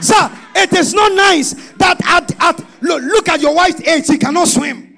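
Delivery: loud, high exhortation; preaching shout. Real, not acted. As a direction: drive it home at full voice. Preacher exhorting husbands loudly.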